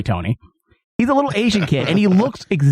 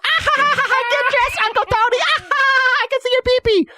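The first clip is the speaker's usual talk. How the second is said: put-on much higher, giggling little boy introducing himself; giggly nephew voice. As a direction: play the cackling kid, way up high. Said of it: "his nephew is like".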